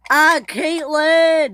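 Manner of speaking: loud, high, drawn-out scream. A narrator's reading. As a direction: scream the name in alarm, stretched long. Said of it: "he screamed".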